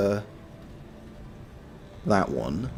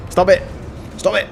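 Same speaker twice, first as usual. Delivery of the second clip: yelling; 8 semitones higher, 5 dB louder than usual. Real, not acted. Player yelling at game enemies.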